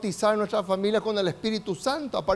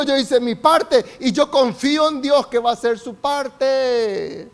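loud, raised preaching voice as the trusting farmer; confident proclamation; 4 semitones higher, 6 dB louder than usual. Acted. Preacher voices the farmer trusting God with the seed, loud confident proclamation. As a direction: proclaim it at full force, pitched up.

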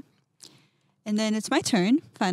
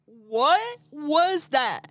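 higher, very slow, unlike her own voice; startled question; 6 semitones higher, 4 dB louder, much slower than usual. Retold or imitated. Reacting to the voice in her head, slow and startled. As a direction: stretch it out in disbelief. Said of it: "And I was like".

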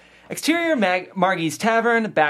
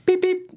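two quick electronic beeps; high beep imitation. Narrator mimics the keychain remote beeping. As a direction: chirp it like a car remote, high.

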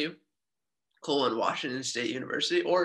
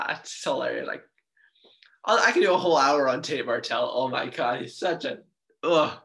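ordinary talk

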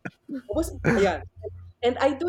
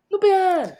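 higher, louder mock-exasperated what-is-this; exasperated groan; 9 semitones higher, 7 dB louder, faster than usual. Real, not acted. Teacher reacting to romantic relationships topic, mock exasperation. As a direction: throw up your hands at it.

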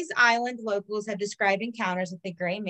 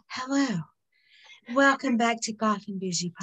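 smooth radio commentator voice. Shown as a demonstration. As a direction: announce it like a radio host. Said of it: "I'm gonna turn on my, um, commentator voice".

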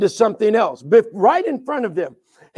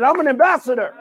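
raised, emphatic declaration; like meaning it; 3 semitones higher, faster than usual. Shown as a demonstration. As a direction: declare it with real conviction. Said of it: "someone say it like you mean it".

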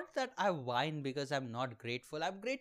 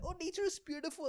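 much higher, fast sing-song gush; mocking influencer; 11 semitones higher, 3 dB quieter, faster than usual. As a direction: toss it off like a gushing tourist. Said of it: "And they're like".

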